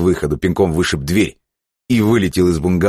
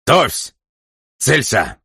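high, sharp firing-squad commands; barked; 9 semitones higher, much slower than usual. A narrator's reading. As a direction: bark each command separately. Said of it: "гаркнул он". (гаркнуть команду)

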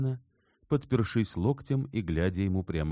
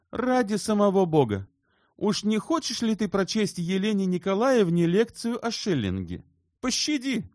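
high, loud mock-desperate protest; exclaiming plea; 5 dB louder than usual. A narrator's reading. Shubin begs his friend to spare them a lecture, exclaiming. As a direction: beg theatrically to be spared. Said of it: "воскликнул вдруг Шубин".

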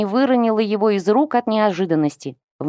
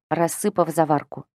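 ordinary delivery

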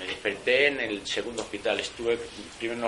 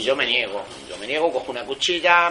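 defiant; 2 semitones higher, 7 dB louder than usual. Real, not acted. Refusing the prison transfer.

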